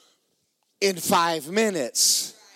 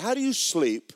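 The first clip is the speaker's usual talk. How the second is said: usual speaking voice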